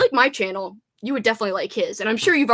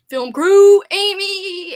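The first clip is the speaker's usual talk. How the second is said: cheer; 7 semitones higher, 9 dB louder, much slower than usual. Real, not acted. Cheering her team.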